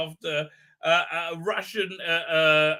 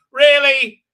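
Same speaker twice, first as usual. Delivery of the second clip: scornful disbelief; 6 semitones higher, 13 dB louder than usual. Real, not acted. Scoffing at war news.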